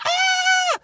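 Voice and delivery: very high-pitched panicked shriek; freaked-out scream. Retold or imitated. Worker watching all the chips fall, freaked-out scream. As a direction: scream as if everything just fell. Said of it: "I freaked the hell out".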